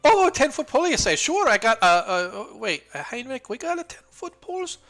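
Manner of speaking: high, loud, chirpy shopkeeper calling her son; fussy shopkeeper woman. Acted. Shopkeeper greets newcomers, calling her son in a high voice.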